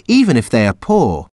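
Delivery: usual speaking voice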